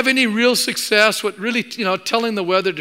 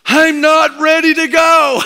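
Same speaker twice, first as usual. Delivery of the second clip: loud, high, slow panicked cry; freaking out; 9 semitones higher, 10 dB louder, slower than usual. Retold or imitated. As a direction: imitate a terrified brother wailing he isn't ready. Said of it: "just freaking out".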